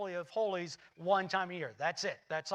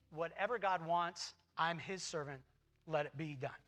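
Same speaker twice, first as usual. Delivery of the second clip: humble surrender; 5 semitones lower, 7 dB quieter than usual. Pretend. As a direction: lower and softer, yielding. Mary's humble acceptance.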